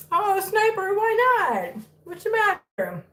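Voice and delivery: high-pitched plaintive cooing; pet talk. Real, not acted. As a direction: question a naughty kitten in a high voice. Coaxing the biting kitten.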